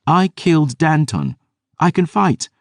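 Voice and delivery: put-on insistent girl. Dior argues with Gabriel.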